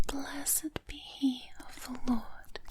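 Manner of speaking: ordinary delivery, nothing marked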